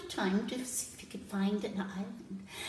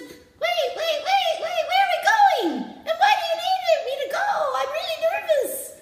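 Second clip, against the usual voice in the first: very high, louder, panicky monkey; nervous monkey; 11 dB louder than usual. Acted. Monkey taps the jellyfish, very high and panicky. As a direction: squeal it, scared of the open sea. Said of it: "Monkey got nervous".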